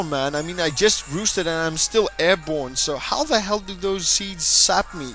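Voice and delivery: usual speaking voice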